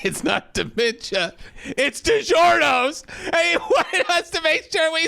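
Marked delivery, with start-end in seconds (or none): 1.6-3.0 slow punchline, much higher and louder. Acted. Friends joking on a podcast, slow and loud punchline. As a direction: land the pun big and slow.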